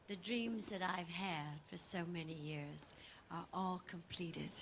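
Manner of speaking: soft, breathy, moved whisper; hushed with feeling; emotional whisper. Real, not acted. Emotional speech.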